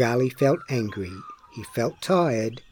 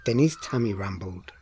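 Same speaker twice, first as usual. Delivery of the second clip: ordinary delivery, nothing marked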